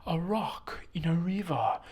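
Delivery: slow, high-pitched mock Chinese accent; put-on Chinese accent, drawn out like a solemn saying. Speaker quoting a beautiful Chinese saying, mock Chinese accent. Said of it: "This is my Chinese accent".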